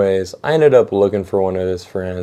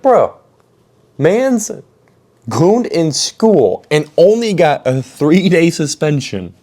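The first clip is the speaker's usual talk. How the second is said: retold disbelief; 6 semitones higher, slower than usual. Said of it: "And I'm like".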